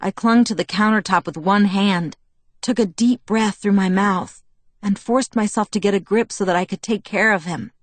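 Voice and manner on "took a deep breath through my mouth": ordinary delivery, nothing marked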